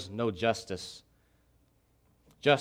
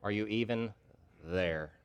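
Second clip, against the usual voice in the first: quiet doubt; 5 semitones lower, 8 dB quieter than usual. Acted. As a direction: lower and softer, almost despairing. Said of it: "in essence, is saying".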